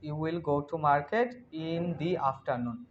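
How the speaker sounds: slow, careful teacher model; slow model sentence. Shown as a demonstration. Teacher modelling the answer slowly for the class. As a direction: lay out each word slowly for the class.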